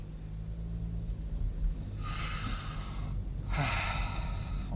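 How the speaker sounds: long, drawn-out sigh; deep breath. Real, not acted. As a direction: take a deep breath and let it all out slowly. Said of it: "Now take a deep breath here".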